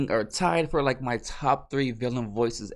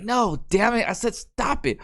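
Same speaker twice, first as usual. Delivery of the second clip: higher-pitched mock exasperation; exasperated scolding; 8 semitones higher than usual. Mando orders robot not to self-destruct, exasperated. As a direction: scold a stubborn droid, pitched up.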